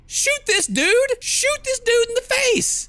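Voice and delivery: high, loud, drawn-out shout; frantic shouted order. Acted. Captive urging Isabella to shoot, frantic high shout. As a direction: yell it slowly at top pitch.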